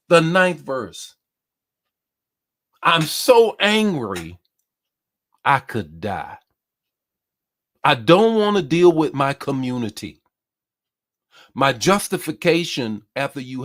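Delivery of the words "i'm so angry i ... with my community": angry Jonah. Acted. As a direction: cry it out as the furious prophet. Preacher voices angry Jonah.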